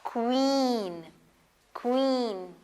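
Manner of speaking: क्वीन with a long, held ee; drawn-out, carefully modelled क्वीन; dragged double e. Shown as a demonstration. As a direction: drag out the ई in क्वीन. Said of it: "जब double ई साथ आएंगे तो आप ई की sound को drag करेंगे".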